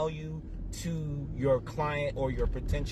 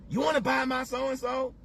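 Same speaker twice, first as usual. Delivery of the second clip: much higher, mocking spam-seller voice; pushy salesman; 10 semitones higher than usual. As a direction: parody a pushy inbox sales pitch. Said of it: "they talk about".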